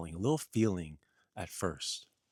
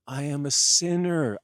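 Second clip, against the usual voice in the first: loud confession; 5 semitones higher, 9 dB louder than usual. Acted. Jesus denies being Messiah.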